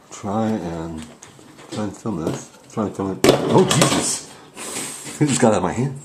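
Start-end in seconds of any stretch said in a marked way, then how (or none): 3.3-6.0 hammed-up startled yelp, louder and higher; mock panic as the board jumps from his hand; mock fright. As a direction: play the spooked ghost hunter, overdone. Ouija board jerks from hand.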